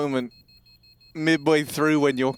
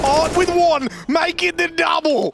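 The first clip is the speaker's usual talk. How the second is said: a caster showing off a mock Australian accent; hammed-up Aussie accent; 9 semitones higher than usual. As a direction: ham up a broad Aussie caster voice. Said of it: "your best Harry impression".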